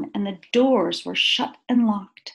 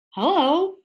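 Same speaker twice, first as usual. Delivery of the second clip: surprised hello; 6 semitones higher than usual. A narrator's reading. Watchman discovering Corduroy, surprised hello.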